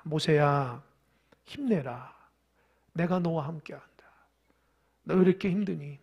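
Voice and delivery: soft, low voice of a comforting God; acted fatherly comfort, hushed and low; gentle reassurance. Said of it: "하나님께서 나타나셔서 얘기합니다". (나직이 다독이는 하나님 목소리)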